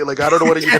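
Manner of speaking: normal talking voice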